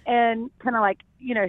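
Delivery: ordinary delivery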